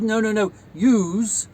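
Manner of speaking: mock lecturing on grammar; correcting father. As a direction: imitate a dad explaining the word firmly. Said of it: "correcting me on grammar".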